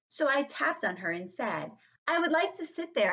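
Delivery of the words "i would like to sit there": high-pitched polite demand; prim, high little request; child's request. Acted. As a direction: ask for the seat in a higher kid voice. Child asks for a taken seat.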